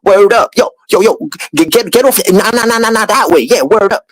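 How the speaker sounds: imitating teammates' slang, hammed up; bouncy high mock street talk. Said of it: "So I would just talk like them".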